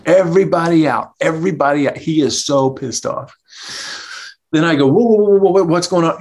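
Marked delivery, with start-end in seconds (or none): 0.1-2.0 mock the owner barking everyone out; imitating angry yell. Furious owner throwing everyone out. 4.9-6.2 high, loud alarmed whoas; mock-alarmed, trying to calm him down; startled whoa. Retelling calming angry owner.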